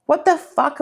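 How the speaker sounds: ordinary talk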